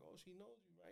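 softly checking, barely voiced; hushed mom aside. Mom quietly asks her son about a stranger, hushed. Retold mom's discreet aside to him.

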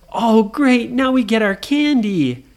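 put-on high, loud kid cheer; acted childish excitement; excited brothers. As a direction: pipe up delighted and expectant. Brothers expect candy.

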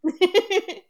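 high, loud burst of laughter. Real, not acted. Laughs at listener's remark.

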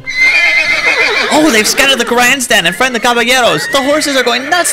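frantic, high, loud commentary; shrieking as the parade falls apart; panicked commentators. Acted. Parade float chaos.

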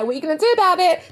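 high, fast taunting dare; mock cheeky kid. Kid at the plug taunting the parent, high fast dare. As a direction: dare them like a cheeky child.

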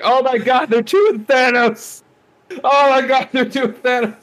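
very high, loud, drawn-out squealing; hammed-up fan excitement. Fan re-enacting the Thanos reveal in theater, hammed-up excited squeal.

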